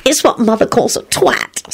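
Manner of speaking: high, pleased explanation; satisfied. A narrator's reading. Solange explaining a pet name, satisfied. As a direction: say it smugly pleased. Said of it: "Solange replied with satisfaction".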